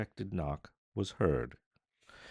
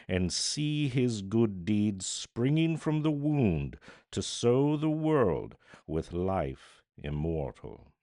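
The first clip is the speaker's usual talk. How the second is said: solemn declamation; 4 dB louder than usual. A narrator's reading. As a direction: proclaim it like an epitaph. Unseen voice pronouncing over the dead.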